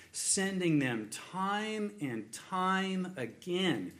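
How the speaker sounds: drawn-out, measured scripture reading; slow reading. Shown as a demonstration. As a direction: stretch each word out slowly. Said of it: "read it slowly".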